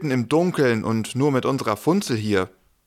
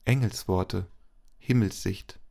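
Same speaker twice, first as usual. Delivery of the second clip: solemn blessing; 3 semitones lower, 3 dB quieter than usual. Acted. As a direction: bless them gently and solemnly. Pastor speaks closing blessing.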